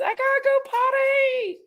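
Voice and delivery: high, squeaky pet voice; high baby-talk dog voice; dog's voice. Pretend. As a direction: speak for the dog in a little high voice. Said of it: "she says".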